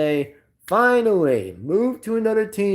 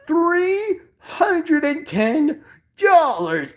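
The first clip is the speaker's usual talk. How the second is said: slow, high wail of disbelief; hammed-up shock, straining high; voice near cracking; 10 semitones higher, much slower than usual. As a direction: squeal the price out word by word. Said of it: "my voice is gonna crack".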